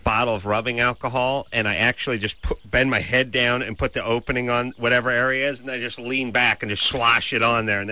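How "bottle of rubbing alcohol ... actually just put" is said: normal talking voice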